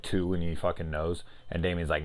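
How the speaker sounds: ordinary talk, nothing marked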